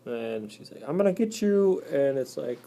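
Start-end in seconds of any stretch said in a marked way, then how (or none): 0.9-1.8 high, mock-menacing spider; lightly voiced menacing spider; playful threat. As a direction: tease the threat out.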